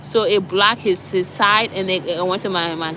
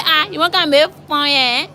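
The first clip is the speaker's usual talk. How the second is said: high mock Liberian English; the cook Grace teasing, high Liberian accent; 7 semitones higher, 5 dB louder than usual. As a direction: tease back in Liberian English, pitched up. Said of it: "She said".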